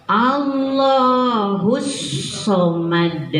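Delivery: very slow "Allahus" with doubled l; pressed lam. Shown as a demonstration. Teacher modelling the pressed lam, slow. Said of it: "itu ditekan ya".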